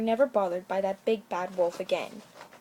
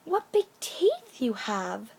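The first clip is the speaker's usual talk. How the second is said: put-on higher, slow amazed girl voice; drawn-out childish wonder; wondering little girl; 7 semitones higher, 4 dB louder, much slower than usual. A narrator's reading. Little girl to grandmamma, higher and slow.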